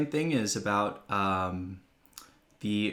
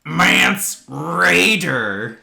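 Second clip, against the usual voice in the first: drawn-out, much louder name call; mock-dramatic bellow; 8 semitones higher, 12 dB louder, much slower than usual. Co-host hamming up a character's name in the recap, mock-dramatic bellow. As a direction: bellow the name slowly and grandly.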